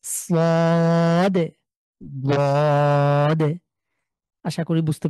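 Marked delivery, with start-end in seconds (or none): 0.0-1.5 long drawn-out Arabic letter name; Arabic letter sound held long; Arabic letter drill. Shown as a demonstration. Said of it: "এখানে হসন্ত আছে". 2.2-3.5 long drawn-out Arabic letter name; Arabic letter sound held long; Arabic letter drill. Shown as a demonstration. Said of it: "এখানে হসন্ত আছে".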